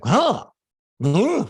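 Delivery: high, loud puzzled huh; intrigued double huh; surprised huh. Retold or imitated. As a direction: voice a sudden aha moment, puzzled.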